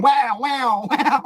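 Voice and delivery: high, loud comic "wow" noises with a laugh; imitated stretching eyelid. Storyteller imitating his hooked eyelid, comic high wail. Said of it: "You should see my eyelid going".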